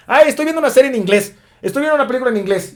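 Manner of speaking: high, fast, pleased-with-itself learner voice; mock smug learner. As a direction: parody a student bragging about watching films in English. Mocks a self-satisfied learner. (voz burlona, aguda y rápida de alumno presumido)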